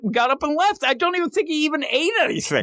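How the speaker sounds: high-pitched exasperated ranting; incredulous. Real, not acted. Podcaster recapping a character's abrupt exit, incredulous. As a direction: rant in high-voiced disbelief.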